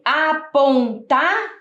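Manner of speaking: "apontar" slowly split as a-pon-ta; syllable by syllable. Shown as a demonstration. Teacher showing what is written so far, "apontar" syllable by syllable. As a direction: sound out "apontar" in separate syllables, left open at the end. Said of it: "tá faltando a sílaba dor".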